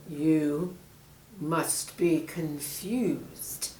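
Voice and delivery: menacing snake. Acted.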